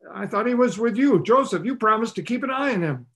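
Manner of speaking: a hint of frantic Mary blaming Joseph; Mary's exasperated voice. Mary scolding Joseph about losing Jesus, exasperated.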